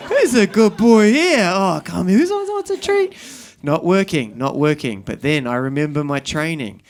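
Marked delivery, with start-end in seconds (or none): 0.1-3.1 put-on high, excited dog-owner baby talk; coaxing praise. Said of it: "trying to use positive reinforcement".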